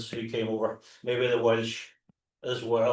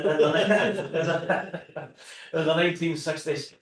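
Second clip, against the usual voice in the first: slowed by laughter, louder on the restart; laughing; 4 semitones higher, 3 dB louder, much slower than usual. Real, not acted. Speaker corrects a slip in the dates to the audience, laughing. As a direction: break into laughter mid-phrase.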